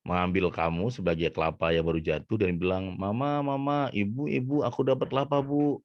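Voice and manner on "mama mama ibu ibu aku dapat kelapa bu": put-on high-pitched child calling for mother; excited small child. Child tells mother about the coconut found, high child voice. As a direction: call out high like a little kid with news. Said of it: "ada anak kecil lari".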